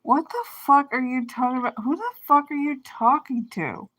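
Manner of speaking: high, sharp disbelief, what the fuck; retold angry comeback; incredulous outburst. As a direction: snap back in outraged disbelief. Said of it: "I'm like".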